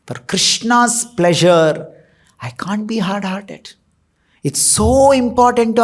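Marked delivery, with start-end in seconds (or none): 2.4-3.7 sudden hushed whisper for emphasis. Real, not acted. Preacher whispers his point to the congregation, hushed. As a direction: drop to a breathy hush to stress the point.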